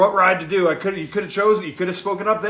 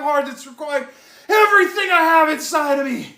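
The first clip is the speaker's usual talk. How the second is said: pleading, exhausted shout; yelling back at the chat's advice; real yelling; 9 semitones higher, 3 dB louder than usual. Real, not acted. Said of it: "Look, I don't mean to yell".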